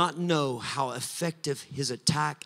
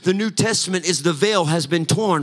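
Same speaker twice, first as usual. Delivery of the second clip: louder, higher preaching with a musical lilt; preaching at full force; 3 semitones higher, 7 dB louder than usual. Acted. Pastor rousing the congregation, near-sung full-force preaching. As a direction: lift it into a near-sung sermon climax. Preaching climax on the torn veil.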